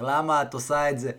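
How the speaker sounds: ordinary talk, nothing marked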